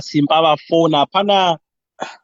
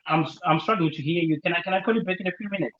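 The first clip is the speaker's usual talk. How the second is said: hushed, pretending the line is bad; dodging a caller; 7 dB quieter than usual. Real, not acted. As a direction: stall the caller, softer. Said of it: "I'm rejecting her".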